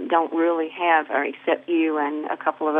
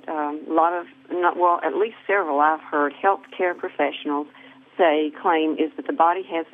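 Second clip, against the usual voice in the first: ordinary talk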